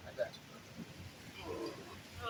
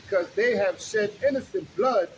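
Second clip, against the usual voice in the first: slow, loud preaching read; booming street-preacher reading; scripture reading; 2 semitones higher, 3 dB louder, slower than usual. Acted. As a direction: proclaim the verses to the crowd. Reads scripture aloud on street.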